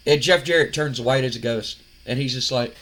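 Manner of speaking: usual speaking voice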